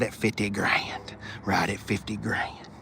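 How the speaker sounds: hushed, breathy price drop; whispered price reveal. Real, not acted. Whispering the price to viewers.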